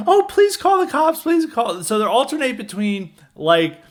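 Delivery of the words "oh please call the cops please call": hammed-up high plea for the cops; mock pleading. Woman begging coworkers to call cops, mock panic. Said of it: "And then they're like".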